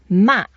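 short MA falling sharply from high; fourth tone. Shown as a demonstration. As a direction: drop "Ma" firmly downward, as if quite angry. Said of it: "we go down".

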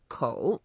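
kou held long, low dip then rise; lengthened third tone. Shown as a demonstration. Teacher showing it can be long, lengthened third tone. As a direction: stretch kou down and back up. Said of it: "you can make it as long as you want".

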